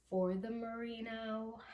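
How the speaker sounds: ordinary talk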